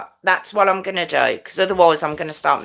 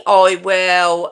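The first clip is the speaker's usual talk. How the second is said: ordinary delivery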